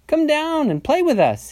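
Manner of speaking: high, loud piggy call. A narrator's reading. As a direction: call up to a busy brother in a little pig voice. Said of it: "he called".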